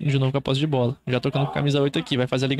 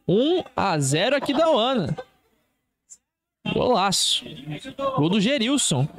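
excited goal commentary; 7 semitones higher than usual. Real, not acted. Celebrating the goal afterwards.

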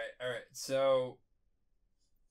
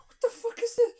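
very high, louder mock disbelief; shocked squeal; 5 dB louder than usual. Acting out the player's reaction afterwards, shocked high squeal.

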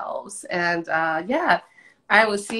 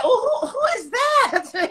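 much higher, slow, startled at her own reflection; mock startled; slower than usual. Joking to her friend about waking up older, mock startled. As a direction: play the shock of seeing yourself in the morning. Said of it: "You be like".

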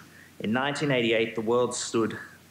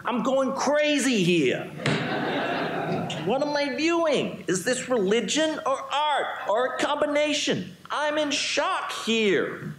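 high, slow, hammed-up New York accent; exaggerated New York accent, high and drawn out; slower than usual. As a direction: play the baffled New Yorker in a thick New York accent. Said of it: "here I get to do my New York accent".

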